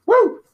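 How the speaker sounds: short, loud, high-pitched woo; playful cheer after a plug; high whoop. Pretend. Host capping a mock Jeep ad, high whoop.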